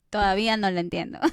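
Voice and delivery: normal talking voice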